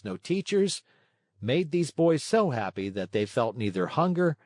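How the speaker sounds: plain reading voice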